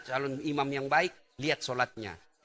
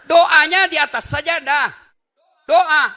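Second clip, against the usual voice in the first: emphatic shout; 14 semitones higher, 15 dB louder, slower than usual. Real, not acted. Preacher scolding mothers who pray by status, loud and high. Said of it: "preacher correcting mothers' habit".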